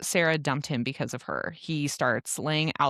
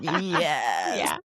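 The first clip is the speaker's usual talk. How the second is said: triumphant yes; 4 semitones lower than usual. Retold or imitated. Listener's reaction to the book.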